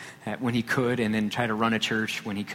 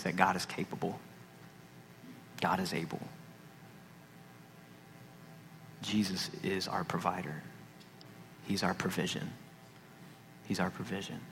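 softer, lower earnest declaration; quiet preaching; 4 semitones lower, 12 dB quieter than usual. Acted. Pastor assures the congregation God provides, quiet and sincere. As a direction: let each truth land softly.

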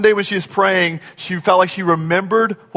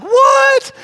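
high-pitched, louder cry of disbelief; shocked dad; 4 dB louder than usual. Retold or imitated. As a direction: yelp it like an alarmed father. Dad's shocked reaction retold.

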